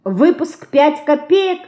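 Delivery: "Выпуск пять копеек" high, rising to a question; high-pitched, wondering query; puzzled echo question. A narrator's reading. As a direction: repeat it back slowly in surprise. Sister echoes it back, puzzled.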